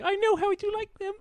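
squeaky, very high Cockney voice; a tiny creepy Cockney doll; mock Cockney doll. Doll serving the babysitter's favorite food, mock Cockney. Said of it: "Cockney accent".

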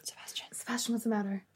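hushed and worried; whispering. Acted. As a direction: ask quietly so nobody hears.

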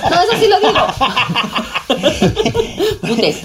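laughing while talking; words spill out through laughter. Real, not acted.

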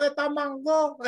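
high, loud Dominican Spanish; Dominican accent. Pretend. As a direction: throw on a thick Dominican accent. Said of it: "break out with your Dominican accent".

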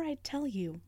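ordinary delivery

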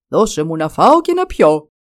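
lightly voiced dragon, loud demand. A narrator's reading. Dragon demanding food in a tale.

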